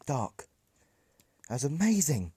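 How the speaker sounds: hero-struck fan shouting in delight; amazed shout. Acted. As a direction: cry it out in wonder. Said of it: "she shouts with utter amazement in her voice".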